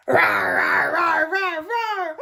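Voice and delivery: loud mock growling roar; bear roar. Bear roaring.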